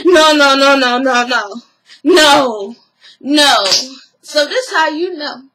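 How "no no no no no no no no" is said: loud, rapid no's; frantic denial. Retold or imitated. Scrappy cutting Erica off before she speaks, panicked. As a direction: cut her off in a panic. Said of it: "Scrappy was like".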